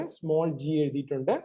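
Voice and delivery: usual speaking voice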